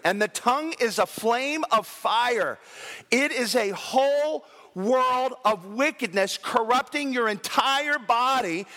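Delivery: slow, solemn scripture read. Acted. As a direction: read it slowly like holy writ. Reads scripture.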